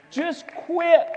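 fiery pulpit outburst; preacher's shout. Real, not acted. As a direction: snap the congregation to attention. Preacher rebuking the congregation.

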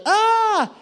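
high, loud mock scream; imitated scream. People screaming over the phone, high imitated shriek. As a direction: scream like a panicked roomful of people. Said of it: "I could hear people screaming".

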